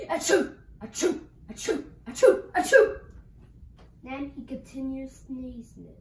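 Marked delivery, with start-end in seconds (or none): 0.1-3.0 string of acted "achoo" sneezes, growing louder; pretend sneezes. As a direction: sneeze over and over, biggest at the end. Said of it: "The wolf sneezed and sneezed and sneezed".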